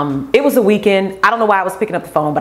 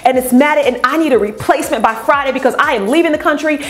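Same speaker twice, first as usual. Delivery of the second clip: higher-pitched, breathless run-on complaint; angry customer rant; 6 semitones higher than usual. Retold or imitated. Customer with tangled hair to the rep, angry rant. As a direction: imitate a frustrated customer going off. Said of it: "she was just going off".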